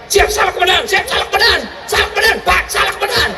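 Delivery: high clipped-free repeated military reply; mock scolded policeman. Policeman answering angry commander, mock drill reply. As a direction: bark it like a scolded subordinate.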